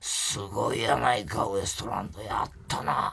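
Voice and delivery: quietly marvelling in Kansai dialect; Tsurube. Retold or imitated. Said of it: "昨日は鶴瓶師匠が".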